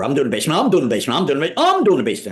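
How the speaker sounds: mock siblings fighting over a chore, building up; kids squabbling. Siblings fighting over the paying basement job, building mock squabble. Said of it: "we'd be fighting over".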